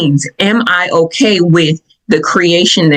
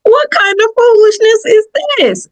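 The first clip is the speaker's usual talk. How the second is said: much higher, outraged mock protest; indignant. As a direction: act fed up with the nonsense. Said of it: "I'm like".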